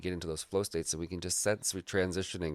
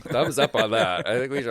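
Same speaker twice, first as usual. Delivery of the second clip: laughing; 7 semitones higher, 11 dB louder than usual. Real, not acted. Laughing while joking.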